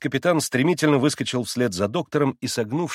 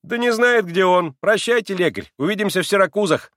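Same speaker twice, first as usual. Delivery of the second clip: high, loud, breezy jibe; mocking schoolboy, pitched high; insolent taunt; 9 semitones higher, 5 dB louder than usual. A narrator's reading. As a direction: toss a cheeky goodbye at the doctor. Insolent boy taunts the doctor.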